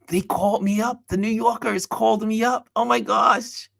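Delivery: high-pitched giddy amazement; sustained high, excited retelling; thrilled disbelief. Retold or imitated. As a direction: relive the thrill of getting the call. Relives thrill of magazine calling.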